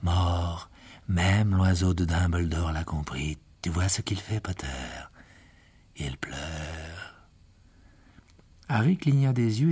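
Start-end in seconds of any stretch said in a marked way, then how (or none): 0.0-7.3 low, slow menacing gloat; cold villain. A narrator's reading. Riddle gloating over the fallen Harry, lightly voiced cold. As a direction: savour the victory.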